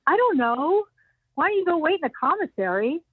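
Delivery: mildly annoyed receptionist. Retold or imitated. Receptionist brushing off the naive newcomer, mildly annoyed. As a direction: brush off the pestering girl lightly. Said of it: "she's annoyed".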